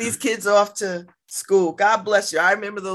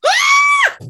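mock shriek, much higher and louder, laughing through it; startled; 8 dB louder than usual. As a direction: act out a startled mother's yelp. Mother startled seeing child.

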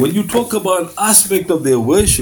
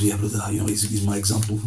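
low, hushed smug brother; a quiet, low-voiced self-satisfied devotee; smug Sufi brother; 8 semitones lower, 8 dB quieter than usual. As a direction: answer quietly, full of yourself. Acted Sufi brother answering.

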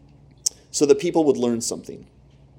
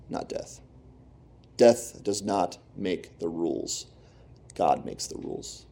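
solemn hush; 4 semitones lower, 7 dB quieter than usual. Real, not acted. Preacher drops to solemn hush.

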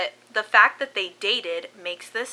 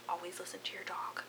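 whispered; 3 semitones lower, 16 dB quieter, faster than usual. Acted. Spooky aside to listeners.

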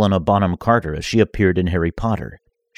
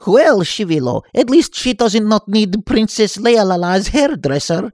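much higher, louder joking voice; joking nerd; 14 semitones higher, 4 dB louder than usual. Acted. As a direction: tease the villains cheekily. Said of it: "Vasily joked".

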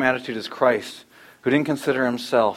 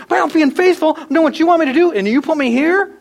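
very high, whining protest to God; mock self-pity, pitched way up; whiny complaint; 13 semitones higher, 6 dB louder than usual. Preacher imagining himself jailed, whining high at God. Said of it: "if I got thrown in prison".

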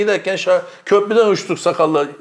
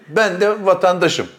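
ordinary talk, nothing marked

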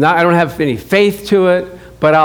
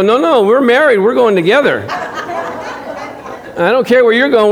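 laughing insistence; 5 semitones higher than usual. Retold or imitated. Insisting on going along.

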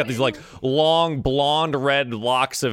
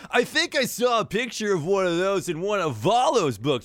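high, loose dude voice, arms wide; surfer dude hug; 8 semitones higher than usual. Acted. Floon calling the pretty lady in for a hug, high dude voice. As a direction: pull her into a goofy grateful hug. Floon describes tentacle man.

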